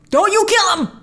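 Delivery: pleading imitation of the trailer line. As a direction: beg them not to kill him. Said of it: "jumping down from the beginning of the trailer".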